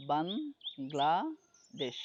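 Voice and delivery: "বাংলাদেশ" very slowly as ban, gla, desh; three separated beats on "বাংলাদেশ"; syllable by syllable. Shown as a demonstration. Teacher showing three-syllable words, "বাংলাদেশ" slowly split ban-gla-desh. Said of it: "trisyllabic words".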